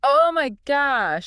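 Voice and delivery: loud, slow astonished gasp; drawn-out disbelief; amazed. Real, not acted. Reaction to settlers with colds.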